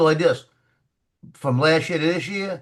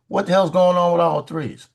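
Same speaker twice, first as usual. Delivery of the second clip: mock angry yell; 5 semitones higher, 4 dB louder than usual. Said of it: "Like that".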